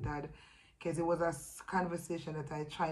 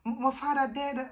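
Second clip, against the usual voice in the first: high, loud Jamaican patois protest; sister in denial; 9 semitones higher, 6 dB louder than usual. Retold or imitated. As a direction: mimic the sister refusing to hear it, high and loud. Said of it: "And she was like".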